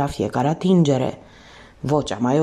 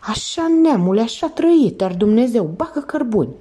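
urging on; 6 semitones higher than usual. Acted. Thief spurring the professor to run, high excited cheer. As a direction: cheer him on to run faster.

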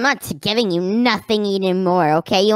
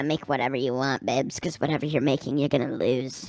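creepy low murmur; 11 semitones lower, 9 dB quieter than usual. Acted. Creepy sister taunts her husband.

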